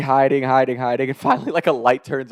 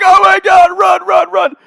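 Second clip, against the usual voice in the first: high, loud mock panic; panicked shout; 9 dB louder than usual. Caught pranksters fleeing the room, panicked shout. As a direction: urge your accomplice to flee, shouting.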